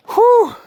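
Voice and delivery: a sharp, high, loud puff of breath after the climb; breathless whew. Real, not acted. Winded from the hill, he lets out a loud high whew. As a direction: blow out hard, winded from the hill. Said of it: "That hill should not have got me out of breath".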